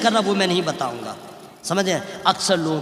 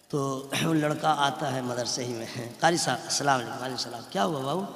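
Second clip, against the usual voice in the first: low, soft storytelling voice; hushed retelling; 6 semitones lower, 6 dB quieter than usual. Retold or imitated. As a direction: tell it quietly, low in the voice. Quiet retelling of boy visiting teacher.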